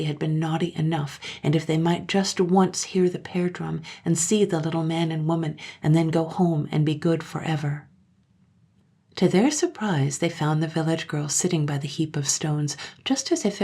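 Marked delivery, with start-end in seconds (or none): none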